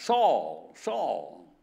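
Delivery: loud, low call of a name; preacher voicing God's loud summons. God calling Saul by name twice.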